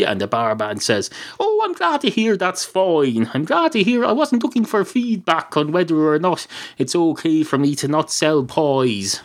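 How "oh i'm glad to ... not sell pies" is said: high-pitched mock-grateful sarcasm; sarcastic retort. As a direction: pitch it up, acting put out. Barman sarcastically retorts to the cat.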